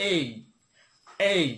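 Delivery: "এই" drilled as e gliding into i; correct letter A. Shown as a demonstration. Teacher has learners say it along, "এই" with an i glide. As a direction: model "এই" crisply, each take by itself. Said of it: "আপনারা আমার সাথে বলতে পারেন".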